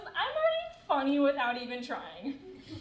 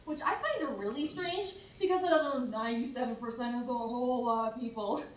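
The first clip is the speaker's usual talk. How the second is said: usual speaking voice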